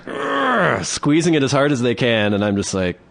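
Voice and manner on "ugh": high strained grunt of effort; straining grunt. Retold or imitated. Girlfriend squeezing his arm, high straining grunt. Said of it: "they're like".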